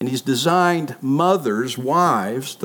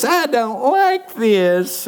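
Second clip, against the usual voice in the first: put-on high, slow whiny little-girl voice; 8 semitones higher, much slower than usual. The baby whining at dinner, high and slow. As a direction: pout it out like a fussy toddler. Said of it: "And the whiner goes".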